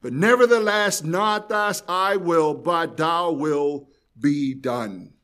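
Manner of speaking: slow, drawn-out prayer, each word weighed; slow prayerful reading. Acted. Preacher voicing Jesus's prayer to the Father, slow and solemn. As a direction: pray it slowly, surrendering.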